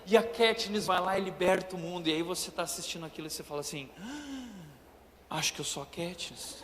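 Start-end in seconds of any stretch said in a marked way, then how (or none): 4.0-6.6 much softer, half-laughing daydream; dreamy aside. Retold or imitated. Teen watching the film dreaming of being Katniss, soft and giggly. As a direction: breathe it like a secret wish.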